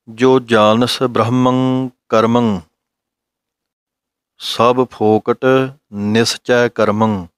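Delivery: very slow scripture chanting; slow recitation. Shown as a demonstration. Teacher reciting the Gurbani line for students, slow recitation. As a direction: chant every word at half pace.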